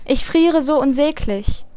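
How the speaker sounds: freezing hare whimpers to be let in; wailing hare. A narrator's reading. Freezing hare begs Vanja at the door, wailing. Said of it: "Der schreit und jammert kläglich".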